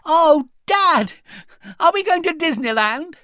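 put-on high, loud, breathless little girl's hope; excited child. A narrator's reading. Little girl hoping for Disneyland, high and loud. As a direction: let her squeal out the guess. Connie guessing the surprise.

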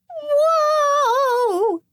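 put-on high girlish yelp while slipping; surprised cry. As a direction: shriek it as you skid. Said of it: "she cried".

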